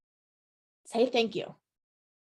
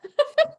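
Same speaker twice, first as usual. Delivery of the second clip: burst of laughter; 6 dB louder than usual. Real, not acted. Laugh after colleague's correction.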